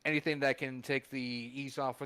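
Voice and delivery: normal talking voice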